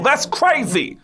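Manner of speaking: very high-pitched Bronx catchphrase; Tracy Morgan. Acted. Girl next door talking like a Bronx comic, high-pitched impression. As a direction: squeal it in a put-on Bronx comic voice. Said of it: "everybody sounded like that".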